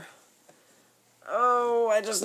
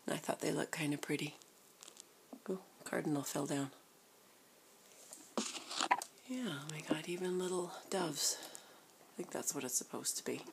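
soft breathy whisper; low whispered aside; 3 semitones lower, 13 dB quieter than usual. Real, not acted. Whispering near the sleeping baby.

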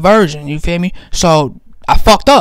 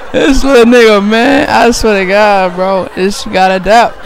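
ordinary talk

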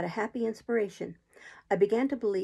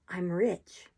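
usual speaking voice

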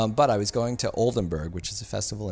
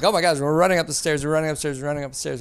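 high, loud, fast panicked babble; frantic rush; 7 semitones higher, 8 dB louder, faster than usual. Retold or imitated. Producer running up the stairs to the Academy, panicked. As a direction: gasp it out while racing up the steps.

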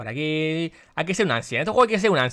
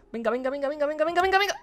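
frantic urging; 10 semitones higher, 4 dB quieter, faster than usual. Real, not acted. Urging on while drowning.

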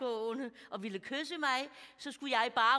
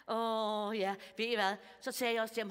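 ordinary talk

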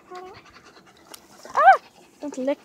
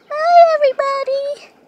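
much higher, much louder sign-off; big loud goodbye; 12 semitones higher, 12 dB louder than usual. Shown as a demonstration. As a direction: shout the goodbye to the viewers. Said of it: "Actually, wait".

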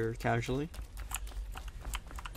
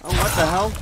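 startled outburst; 8 semitones higher, 11 dB louder than usual. Real, not acted. Ambushed suddenly in the game.

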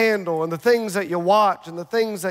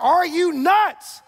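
high, slow mock disbelief; incredulous pastor; 8 semitones higher, much slower than usual. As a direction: act shocked like a pastor. Pastor voiced in mock scene.

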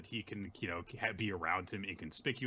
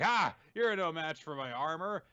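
acted villain boast; 6 semitones higher, 5 dB louder than usual. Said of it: "the guy's like".

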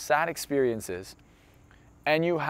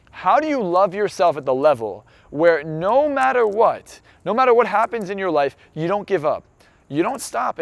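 impassioned; 5 semitones higher, 5 dB louder than usual. Real, not acted. Impassioned motivational peak.

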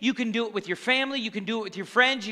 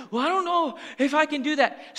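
higher put-on doubter's voice; mock self-doubt; 5 semitones higher than usual. Preacher imitating people who doubt they can, mock hesitant. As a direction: imitate someone unsure, pitched up.

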